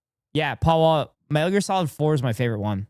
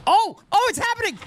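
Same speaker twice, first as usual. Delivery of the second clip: excited. Real, not acted. Video he awaited starts.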